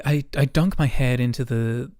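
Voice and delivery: normal talking voice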